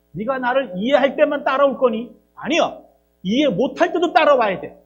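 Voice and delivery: firm God. Acted. God's demand voiced.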